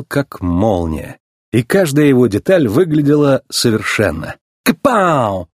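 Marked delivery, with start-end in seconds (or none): 4.6-5.5 higher, showy catchphrase; playful cry. A narrator's reading. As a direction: toss it out to show off. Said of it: "игриво крикнул гонщик".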